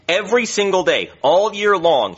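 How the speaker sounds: "Every single day" punched high and loud; emphatic storytelling. Acted. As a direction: hammer each word of "all year long". Storyteller stresses relentless asking.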